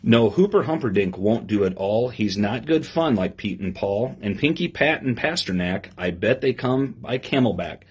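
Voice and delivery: lively storybook reading to a class; reading to children. Acted. Teacher reading a picture book to her class, lively storybook voice. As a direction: read the rhyme aloud with bounce for the kids.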